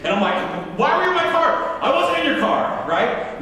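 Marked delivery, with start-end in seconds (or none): 0.8-1.8 high-pitched angry demand; worked-up retold yell. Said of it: "pastor yelling at me". 1.8-2.9 protesting denial. Retold or imitated. Child denying the accusation.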